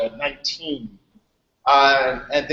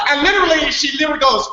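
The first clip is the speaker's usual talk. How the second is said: excited; 9 semitones higher, 4 dB louder, faster than usual. Real, not acted. Building up a funny story.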